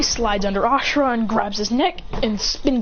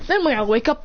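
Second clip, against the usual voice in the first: usual speaking voice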